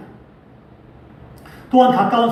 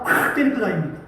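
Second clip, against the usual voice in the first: ordinary delivery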